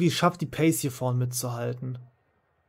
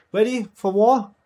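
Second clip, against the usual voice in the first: hyped battle cry; 8 semitones higher, 7 dB louder, slower than usual. Real, not acted. Race start battle cry.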